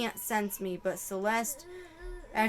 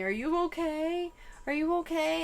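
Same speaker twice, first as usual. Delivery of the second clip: lightly voiced worried friend, higher; Celeste asking; 7 semitones higher than usual. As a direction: ask it like the anxious friend. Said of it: "hearing her tell me".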